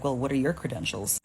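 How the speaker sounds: ordinary talk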